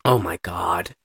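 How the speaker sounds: hushed breathless whisper; whispered oh my God. Real, not acted. Reacting to the Godfather scene.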